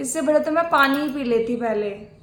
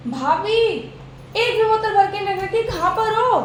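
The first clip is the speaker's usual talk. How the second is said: calling out; 5 semitones higher than usual. Acted. Sister-in-law calls out, annoyed.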